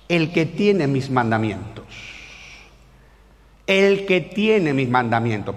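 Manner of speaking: low, slow, deliberate recitation; solemn scripture reading. Acted. Preacher reads John to young people, slow solemn recitation. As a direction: read the verse slowly and weightily.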